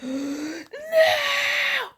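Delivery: high, loud wail of horror; anguished scream. Retold or imitated. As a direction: scream out in grief and shock. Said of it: "she just went off".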